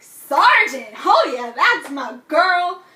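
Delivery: loud, slow proud cheer; mock proud cheer. Character cheering when Blue got suspended, loud proud cheer. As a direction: shout it like a proud buddy.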